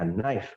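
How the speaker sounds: low "knife", "naif" ending on F. Shown as a demonstration. Teacher pointing out the F, knife as "naif". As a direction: sound the final F of "knife". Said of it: "Ada F-nya".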